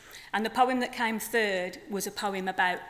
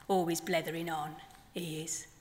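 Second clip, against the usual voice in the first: measured poem delivery with long pauses; poem reading; 6 semitones lower, 8 dB quieter than usual. Acted. Poet reading her flamingo poem to the festival audience, measured. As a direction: let each line land, unhurried.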